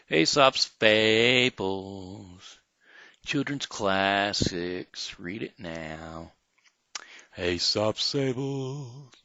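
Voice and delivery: slow, drawn-out title announcement; announcer title. Acted. Host introducing Aesop's Fables to kids, slow and grand. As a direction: announce the book grandly and slowly.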